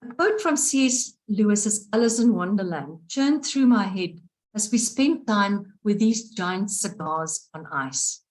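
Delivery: ordinary delivery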